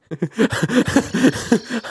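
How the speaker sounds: high wheezy giggle; cracking up. Real, not acted. Streamer breaking at his own soothing voice, high giggling. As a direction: break into helpless high laughter.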